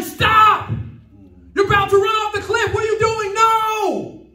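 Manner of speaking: high, loud shouted warning; urgent yelling. Acted. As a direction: yell to stop someone at a cliff edge. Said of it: "Love would be yelling".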